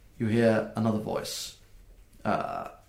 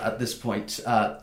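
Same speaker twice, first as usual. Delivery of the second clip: ordinary talk, nothing marked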